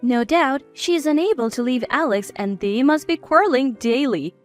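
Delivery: ordinary delivery, nothing marked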